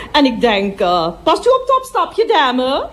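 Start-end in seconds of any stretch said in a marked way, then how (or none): 1.2-2.9 sudden high, sing-song call to a lady; bright-up service voice to a passing customer; polite attendant call. Acted. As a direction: chirp it out like the toilet lady on duty.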